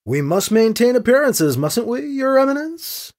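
high, rising teasing formality; mock deference. Priest needling cardinal with his title, mock deference. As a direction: lift EMINENCE high into a needling question.

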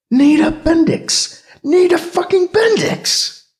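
high, loud, slow astonished cry; shocked outburst. A narrator's reading. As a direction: blurt it out in shock.

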